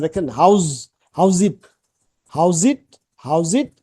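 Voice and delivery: হাউজ ইট with the z sound joined into ইট; linking z sound. Shown as a demonstration. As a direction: run how and is together as হাউজ ইট. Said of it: "জেড আছে".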